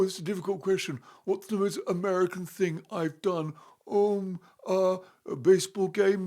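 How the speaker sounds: a lightly put-on American accent, hesitant and fumbling; mock American accent. Mocking Harry's podcast answers in a light American accent. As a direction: mimic a clumsy American twang while groping for answers. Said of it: "Trying to do an American accent".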